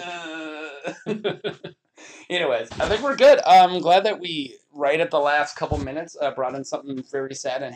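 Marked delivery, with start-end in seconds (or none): 0.0-1.6 wife's grunt. Retold or imitated. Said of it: "She's like".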